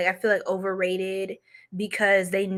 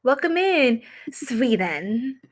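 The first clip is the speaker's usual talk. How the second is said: mock Swedish; 5 semitones higher, slower than usual. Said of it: "I keep doing all these accents".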